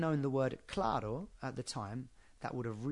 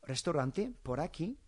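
higher voice, lifting "aquí" at the end; rising question tune; 4 semitones higher, 3 dB louder than usual. Shown as a demonstration. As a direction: ask it with an upswing on "aquí". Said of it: "an inflection at the end of the sentence".

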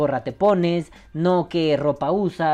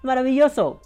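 high, louder hammed-up joy; mock delight; 9 semitones higher, 5 dB louder than usual. Dad mocking his wife's baby sleep idea with hammed-up delight. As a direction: gush like it's wonderful news. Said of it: "fue como".

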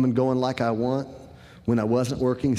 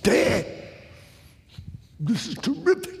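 gushing; 12 semitones higher than usual. Retold or imitated. Son gushing about meal.

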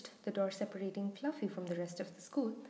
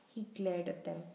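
plain reading voice

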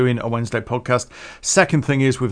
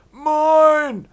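mock call. Fielder calling for the ball.

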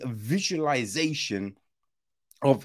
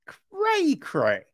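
playful squeak; 12 semitones higher than usual. Real, not acted. Playful slang on film's vibe.

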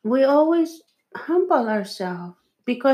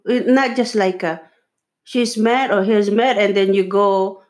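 ordinary talk, nothing marked

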